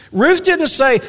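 "didn't" punched high and loud; stress on didn't. Real, not acted. Preacher stressing what Ruth didn't say, high and loud. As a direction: hit "didn't" hard. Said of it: "repeated emphatic setup".

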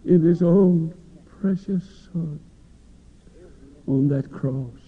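slow, hushed and reverent; reverently. Real, not acted. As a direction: say it with quiet awe, very slowly. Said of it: "Could I say it reverently?".

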